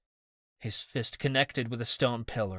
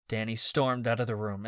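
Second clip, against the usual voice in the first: usual speaking voice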